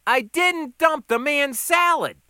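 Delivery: loud, high, emphatic protest; exasperated waiter protesting hotly; indignant denial. Acted. Waiter denies it more forcefully.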